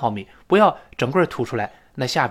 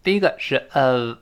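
ordinary talk, nothing marked

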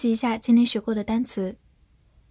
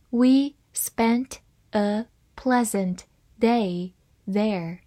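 slowly, word by word with pauses; slow reading; much slower than usual. Shown as a demonstration. Teacher reads the example sentence for learners, slow reading. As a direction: read it slowly for learners, each word apart. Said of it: "慢慢来读".

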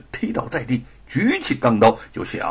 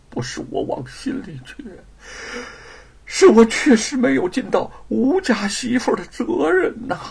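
crying; 10 semitones higher than usual. A narrator's reading. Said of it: "玉凤哭了".

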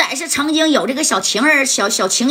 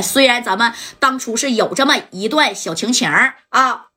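usual speaking voice

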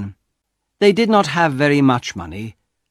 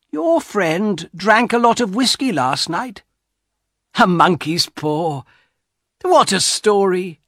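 put-on lightly raised, higher old wife; a touch of a scoffing old wife; wife's voice; 8 semitones higher than usual. A narrator's reading. Wife voiced in read story.

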